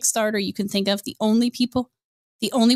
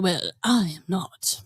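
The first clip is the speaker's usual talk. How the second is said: Edwin voice; 6 semitones lower, 3 dB quieter, slower than usual. Retold or imitated. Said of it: "I'm gonna try to do the Edwin voice".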